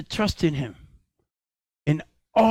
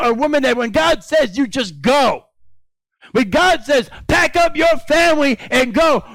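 raised, fervent preaching cadence; 6 semitones higher, 6 dB louder than usual. Acted. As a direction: preach it out to the congregation. Pastor preaching at full force.

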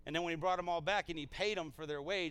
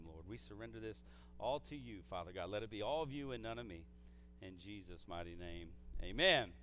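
lower, softer, earnest praying voice; prayer; 5 semitones lower, 5 dB quieter than usual. Real, not acted. Pastor leading the congregation in opening prayer, low and earnest. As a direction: pray softly and low to the Father.

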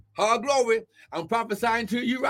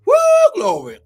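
a high, loud burst of joy; ecstatic whoop; 11 semitones higher, 10 dB louder, faster than usual. Real, not acted. Preacher erupting in praise before the congregation, ecstatic whoop. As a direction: let the praise explode out of you. Ecstatic outburst mid-sermon.

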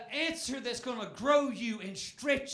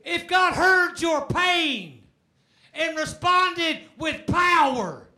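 preaching at full force; 6 semitones higher, 8 dB louder than usual. Real, not acted. Preacher urging the congregation to shout hallelujah. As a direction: whoop it up for the church.

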